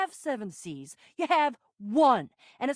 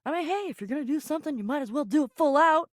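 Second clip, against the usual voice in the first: fast, casual patter; quick aside; faster than usual. Acted. Explaining the over-the-top act, rapid offhand aside. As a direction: toss it off quickly.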